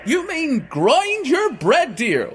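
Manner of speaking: very high, loud warning; urgent giantess. Acted. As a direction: hurry the boy into hiding. Wife corrects giant.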